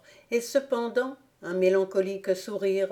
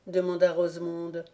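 plain reading voice

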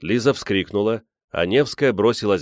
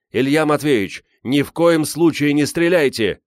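high, loud and insistent; shouting plea; 7 semitones higher, 5 dB louder than usual. A narrator's reading. Зазуля begs the armed man not to shoot, shouting. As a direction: implore him at a shout. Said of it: "кричал Зазуля".

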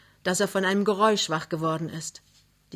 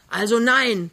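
loud, high angry shout; bellowing; 4 semitones higher, 7 dB louder than usual. A narrator's reading. Neighbour outside gives up on the man, bellowing. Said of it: "brüllt Krümner draußen".

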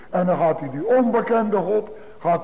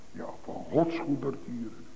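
much softer and lower, almost to oneself; hushed reverent echo; 7 semitones lower, 12 dB quieter than usual. Real, not acted. Preacher recalling the psalm they sang, hushed. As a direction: let the repeated words sink to a hush. Hushed repeat of a remembered song.

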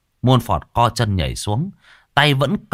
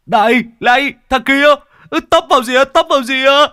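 much higher, loud alarmed shouting; startled yell; 7 dB louder, faster than usual. Acted. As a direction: yell at the man in the road. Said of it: "giật bắn mình". (hét hoảng hốt, cao và to)

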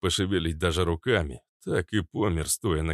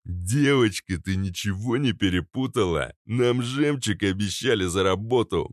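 threatening thug; 4 semitones higher, 3 dB louder than usual. Acted.

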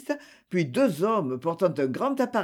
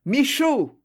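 calling a name through an open door, pitched up; a raised call toward the vestibule; 7 semitones higher, 3 dB louder than usual. Acted. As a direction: call him in from the hall. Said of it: "ouvrit la porte et appela".

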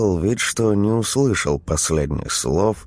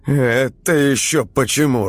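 high, loud baffled question; 9 semitones higher, 3 dB louder than usual. A narrator's reading. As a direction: ask it, thrown off. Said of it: "растерялся Чернотроп".

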